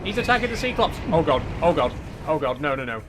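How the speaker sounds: loud panicked cries, pitch up; real panic, voice climbing; terrified horror victim. Real, not acted. Leviathan attacks, player panics.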